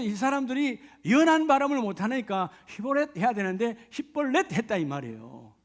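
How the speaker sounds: ordinary talk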